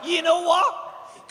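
laughing shout. Real, not acted. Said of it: "repeated louder".

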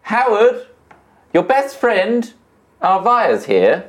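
ordinary delivery

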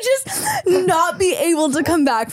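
laughing while talking; cracking up mid-sentence. Real, not acted. Laughing at her own joke.

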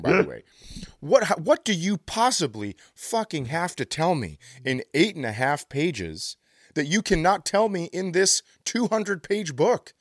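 normal talking voice

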